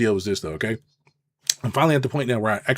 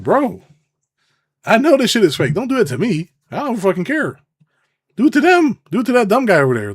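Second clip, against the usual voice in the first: higher, louder protest; raised exasperated protest; fed-up outburst; 10 semitones higher, 6 dB louder than usual. Real, not acted. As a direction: push it away loudly.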